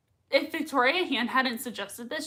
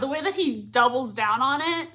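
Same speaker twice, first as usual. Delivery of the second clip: ordinary delivery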